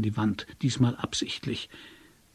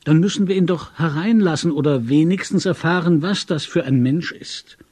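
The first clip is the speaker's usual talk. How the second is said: loud, higher agitated urging; raised, insistent voice; urgent; 6 semitones higher, 7 dB louder than usual. A narrator's reading. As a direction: press a drowsy friend to act. Agitated friend urges letting visitor in.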